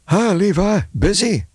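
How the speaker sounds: a jittery man poking his head in; nervous tavern owner. Acted. Tavern owner Charlie greeting Levi, nervous and high.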